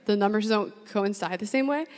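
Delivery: ordinary talk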